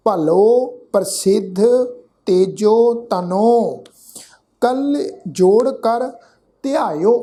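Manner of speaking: slow, emphatic verse reading; slow teaching recitation; scripture recitation. Shown as a demonstration. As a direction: recite word by word, slowly, with pauses. Recites the verse being taught.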